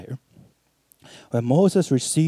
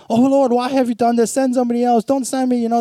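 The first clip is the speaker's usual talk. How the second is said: high, whiny mock complaint; imitating a panicky complainer begging off; complaining; 8 semitones higher, 4 dB louder, faster than usual. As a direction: moan it in a raised, pleading pitch. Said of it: "they're complaining".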